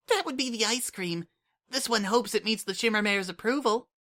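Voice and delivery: put-on high, eager childlike voice; a small kid thrilled about the ice cream; eager little changeling. Kukri telling Sunset the ice cream arrived, eager high voice.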